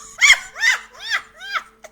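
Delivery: high, loud laugh; burst of laughter. Real, not acted. Host laughing at her own joke about a friend, loud high laugh.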